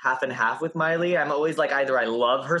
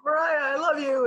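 much higher gushing fan voice; adoring fan caller; 12 semitones higher than usual. Retold or imitated. Imitating fans calling in to [name], much higher and gushing. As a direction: mimic a starstruck caller gushing. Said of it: "callers calling in being like".

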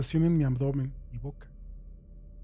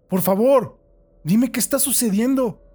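pleading call; 8 semitones higher, 7 dB louder than usual. Acted. Trapped man begging unseen captor.

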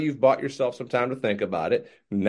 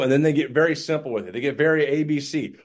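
ordinary delivery

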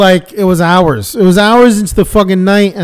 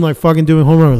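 ordinary talk, nothing marked

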